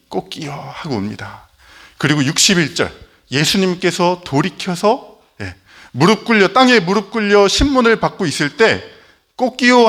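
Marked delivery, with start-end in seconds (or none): 0.1-0.5 soft hushed mock crow; a faint, far-off rooster; quiet rooster crow. Pastor retelling the crow softly, quiet mock rooster. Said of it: "갑자기 닭이". 9.4-9.9 high, loud mock crow; loud rooster crow. Pastor voicing the rooster as Jesus turns, loud mock crow. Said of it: "하는 울음소리".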